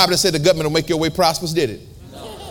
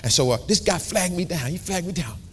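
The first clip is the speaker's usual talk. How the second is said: ordinary talk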